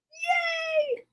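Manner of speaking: high soft little cheer. Real, not acted. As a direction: squeal a quick yay. Cheering the pig.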